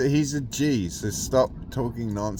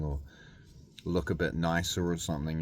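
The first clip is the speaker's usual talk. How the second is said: ordinary delivery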